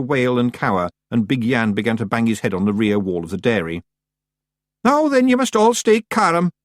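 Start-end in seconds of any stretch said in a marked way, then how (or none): 4.8-6.5 high, loud, slow Scots pixie yell. Acted. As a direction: shout to calm panicking brothers. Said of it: "yelled Rob Anybody".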